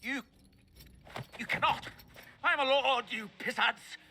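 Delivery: high, loud desperate protest, voice cracking upward; panicked shouting. Acted. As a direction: scream it out as the sentence sinks in, terrified. Prisoner sentenced to Tranquility.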